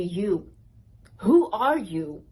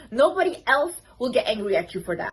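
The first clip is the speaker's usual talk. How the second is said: ordinary talk, nothing marked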